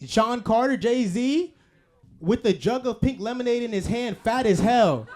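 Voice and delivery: loud, high-pitched hammed-up tribute; impassioned hype. Comic listing a producer's hits to the crowd, loud impassioned hype. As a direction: belt out each credit like undeniable proof. Hyping [name]'s songs to crowd.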